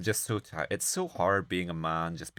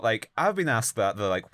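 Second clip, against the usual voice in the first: ordinary delivery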